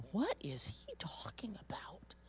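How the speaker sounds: soft unvoiced aside; whispered thought. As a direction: whisper it to yourself. Inner thought during testimony.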